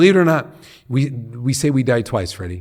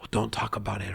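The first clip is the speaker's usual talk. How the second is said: whispered, the words barely breathed; hushed whisper; 7 dB quieter than usual. Retold or imitated. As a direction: whisper it as if the subject were forbidden. Quoting hushed advice about grief.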